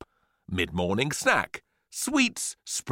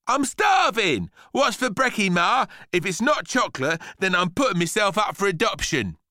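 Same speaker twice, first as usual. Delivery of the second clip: put-on high, loud bratty kid; demanding boy; 10 semitones higher, 5 dB louder than usual. A narrator's reading. Hungry boy demands breakfast from mum, loud and bratty. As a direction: whine loudly for breakfast in a high voice.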